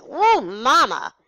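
loud, high, drawn-out whoa; taken aback. Retold or imitated. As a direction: react with mock shock to mom. Acted shock at mom's outburst.

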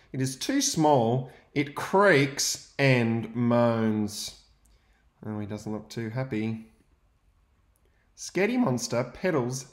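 put-on lively read-aloud for young children; story narration. A narrator's reading. Teacher reading to his class, lively storytelling. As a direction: read the picture book to the class with energy.

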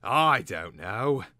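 loud, drawn-out exasperation; complaining. A narrator's reading. Slaughterer picking himself up, complaining. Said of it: "he complained".

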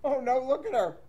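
plain reading voice

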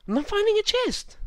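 high, loud frustrated cry; frustrated yell. Real, not acted. Frantic search for a chest.

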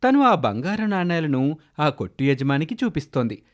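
ordinary delivery, nothing marked

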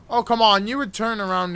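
loud high scared protest; startled, pleading outburst; scared horror game streamer. Real, not acted.

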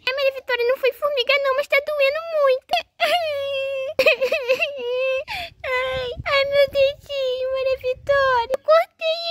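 put-on high, wailing child in pain; acted crying. Boy shows sister his cut finger, crying. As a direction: cry out over a hurt finger. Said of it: "ele tá lá chorando".

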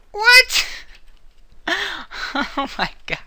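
high startled yelp of what; shocked. Real, not acted. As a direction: caught off guard by surprise. Sudden surprise in game.

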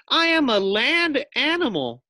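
high, loud insistent voice; indignant guinea pig. A narrator's reading. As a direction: insist like a frightened little pet. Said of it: "thought Fluffy".

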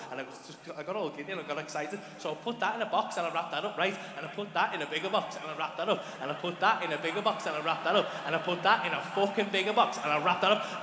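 usual speaking voice

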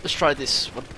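normal talking voice

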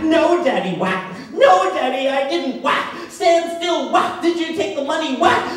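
shrieking very high and loud, pleading and blows; a child screaming under the belt; frantic screaming. Retold or imitated. Beaten boy and father screaming.

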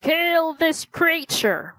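high, slow command in a put-on king voice; angry king's order. King to his guards, a slow angry command. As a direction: order the guards with royal fury. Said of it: "The king became angry".

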